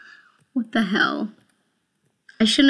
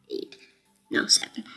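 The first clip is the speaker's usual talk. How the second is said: usual speaking voice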